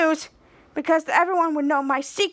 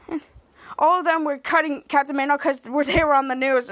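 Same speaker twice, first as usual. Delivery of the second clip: ordinary delivery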